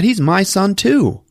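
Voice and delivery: rising high and insistent; imitating a wife arguing back; indignant retort. Parents quarrel over the phone.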